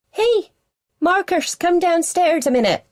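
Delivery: head-banging mum volume; calling out loud. A narrator's reading. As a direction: shout to a son upstairs.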